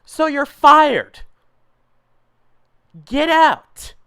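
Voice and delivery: loud, high, drawn-out shout; angry dismissal. Acted. Boss fires squirrely underling, angrily shouting. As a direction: raise the voice and stretch the order.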